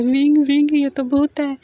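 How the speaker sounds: frightened cry for help; open-mouthed fright. A narrator's reading. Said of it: "वांगजी मुंह फाड़कर बोलता है".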